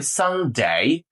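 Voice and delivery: সানডে with a long gliding "ay" in ডে; posh pronunciation. Shown as a demonstration. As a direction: draw out "san-dey", tune dipping then rising. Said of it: "প্রথমে এটাকে ডে বলবেন".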